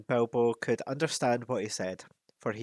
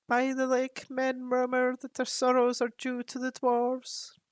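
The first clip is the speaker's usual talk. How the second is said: higher, grave raven voice; counselling raven; 13 semitones higher, 3 dB louder than usual. Acted. Raven Roak counsels Thorin about coming danger. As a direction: warn him like an ancient advisor.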